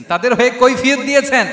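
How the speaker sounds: high, loud insistence; emphatic repetition. Real, not acted. Preacher repeats to the crowd that he pleaded for them, emphatically. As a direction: raise pitch and volume to hammer it. Same point repeated with force.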